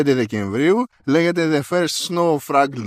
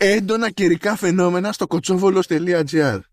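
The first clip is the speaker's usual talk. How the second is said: higher, mock-official alert read; reading an alert email; 6 semitones higher than usual. Host reading the store's weather warning email to his cohost, mock-official. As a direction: announce it like a pompous warning. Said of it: "άκου εδώ mail που ήρθε".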